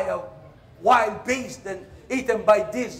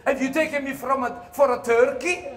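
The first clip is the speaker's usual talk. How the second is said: indignant yelp; 5 semitones higher, 8 dB louder than usual. Retold or imitated. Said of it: "which hurt so much".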